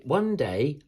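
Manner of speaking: usual speaking voice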